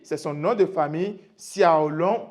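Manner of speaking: ordinary talk, nothing marked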